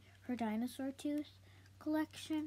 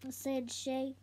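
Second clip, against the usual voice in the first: plain reading voice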